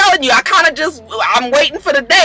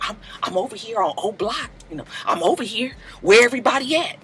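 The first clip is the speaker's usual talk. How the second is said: softly voiced lone braggart; half-whispered mock boasting; imitating a hushed whisperer; 8 dB quieter, faster than usual.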